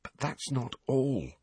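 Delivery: soft, slow, hushed; barely above a whisper; near whisper. A narrator's reading. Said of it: "a voice only a little above a whisper".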